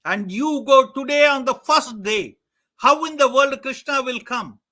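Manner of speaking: building from firm to a loud, high rant; rising furious rant at a lying son; furious father. Acted. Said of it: "his dad was furious".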